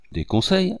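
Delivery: high-pitched exclamation; sharply rising exclamation; exclaimed question. A narrator's reading. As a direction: throw it up high as an incredulous question. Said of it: "s'exclama-t-il".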